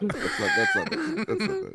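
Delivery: high squeal through laughter; laughing squeal. Real, not acted. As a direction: crack up mid-sentence.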